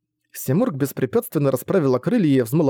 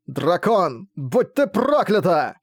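slow, strained, higher curse; running out of air; 5 semitones higher, much slower than usual. Acted. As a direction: spit it out gasping. Dying man curses the AI.

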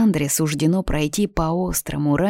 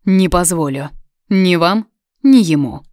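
loud, cutting, rising in force; rude interruption; 2 semitones higher, 7 dB louder than usual. Acted. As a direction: witch snaps back harshly, getting louder. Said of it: "грубо перебит Виданой". (грубо, всё громче)

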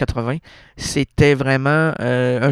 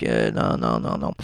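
deep voice; 6 dB quieter than usual. Pretend. Sudden very low voice.

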